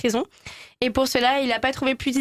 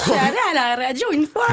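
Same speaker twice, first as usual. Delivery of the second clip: a faster Belgian accent with rolled R; rural Belgian accent; 3 semitones higher, faster than usual. Shown as a demonstration. Guest redoing her Belgian accent when asked. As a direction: roll the R, country Belgian, quicker. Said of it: "tu peux me le refaire".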